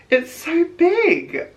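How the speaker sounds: high, loud, slow marvelling; astonished. Real, not acted. Vlogger marvelling at the phone's size, astonished.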